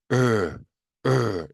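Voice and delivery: "으" sighed out with tongue pulled back; airy, drawn-out "으" coloured like "er"; English R sound. Shown as a demonstration. Teacher models practice takes for learners, "으" retracted and breathy. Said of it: "이 소리가 알 소리예요".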